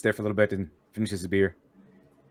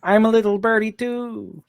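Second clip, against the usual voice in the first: high singsong birdie voice; playful high-pitched little bird; 10 semitones higher than usual. Pretend. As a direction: chirp it sweetly high, drawing out the end. Joking bird voice after bird call.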